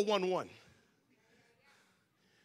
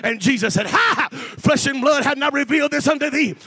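loud, driving preacher's cadence; full-force preaching; 5 semitones higher, 13 dB louder than usual. Real, not acted. Preacher telling listeners to use their own voice, full-force preaching. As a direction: build to a high shout on each big phrase.

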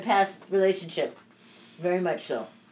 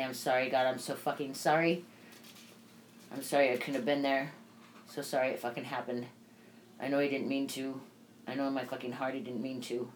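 soft, subdued reading of a grief diary; reading a grief journal; 6 semitones lower, 10 dB quieter than usual. Real, not acted. Widow reading her journal about Byron's death, soft and sad. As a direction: read your own diary entry, holding back tears.

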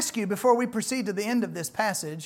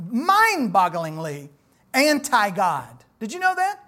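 ordinary talk, nothing marked

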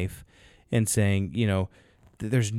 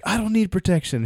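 high, animated retold comeback; mock protest; acted indignant retort; 10 semitones higher than usual. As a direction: play the offended prisoner, voice pitched up. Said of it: "he's like".

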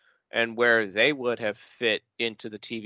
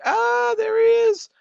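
high, loud delighted exclamation; excited; 8 dB louder than usual. Retold or imitated. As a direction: cry out in gleeful recognition. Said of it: "I do miss being able to be like".

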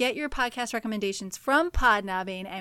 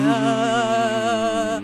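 hollow, louder ghostly moan; 6 dB louder than usual. Pretend. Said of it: "I sound like a ghost".

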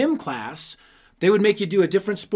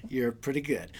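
a self-admiring murmur to his own bicep, lower and softer; murmured repeat; 6 semitones lower, 10 dB quieter than usual. Retold or imitated. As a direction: drop low and soft on the repeat "You're pretty good".

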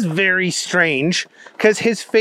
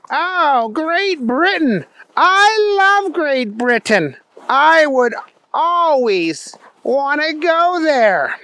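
high, drawn-out, gushing cartoon voice; Booger McFarland; 8 semitones higher, slower than usual. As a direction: gush slowly in a high, goofy voice. Booger quickly fakes enthusiasm.

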